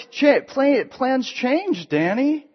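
put-on girl thrilled to see her boyfriend; excited girl. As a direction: gush back happily. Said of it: "She's, like, all excited too".

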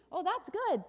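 high, loud burst of delight; delighted refrain. Acted. Storyteller reacts to the balloon ride, loudly delighted. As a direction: cheer the good news.

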